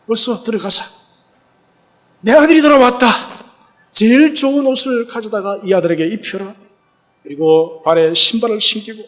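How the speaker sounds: a father overjoyed, summoning the household to celebrate; joyful father. Acted. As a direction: rejoice as the father, calling out for the feast. Father rejoicing at son's return.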